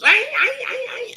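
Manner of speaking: very high, loud mock dog yelps; yelping dog. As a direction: yelp like a hurt dog in a joke. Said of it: "and the dog goes".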